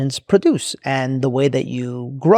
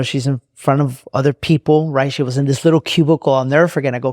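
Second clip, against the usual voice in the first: plain reading voice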